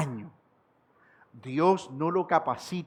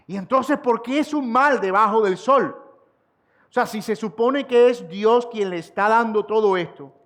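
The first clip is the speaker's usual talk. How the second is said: higher, louder rhetorical questions from the pulpit; impassioned preaching; 7 semitones higher, 5 dB louder than usual. Acted. Preacher challenging his congregation, impassioned and louder. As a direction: press the congregation with question after question.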